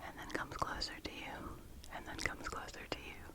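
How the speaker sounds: soft ASMR whisper; breathy close-mic whisper. Real, not acted. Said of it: "I was just doing some whispering".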